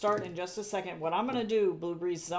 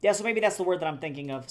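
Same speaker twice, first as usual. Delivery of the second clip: ordinary talk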